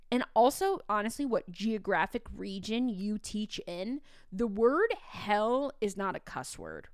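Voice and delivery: ordinary talk, nothing marked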